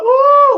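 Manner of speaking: short, very high, loud shriek on oh; mock scream. Running off from fans, a shrill Morty squeal. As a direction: let out one shrill squeal. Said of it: "I'd be screaming".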